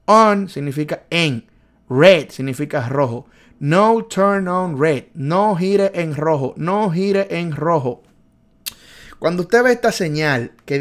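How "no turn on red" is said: slow drill. Shown as a demonstration. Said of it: "Turn significa girar".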